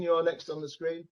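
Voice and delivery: usual speaking voice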